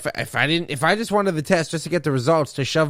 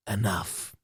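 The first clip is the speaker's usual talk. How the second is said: ordinary talk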